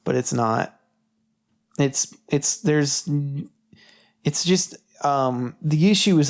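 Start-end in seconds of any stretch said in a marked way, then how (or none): none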